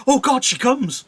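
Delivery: high, loud burst of excitement; excited Juliet. Acted. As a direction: spot the nurse and cry out. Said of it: "She's excited now".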